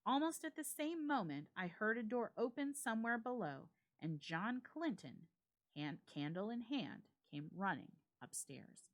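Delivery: ordinary delivery, nothing marked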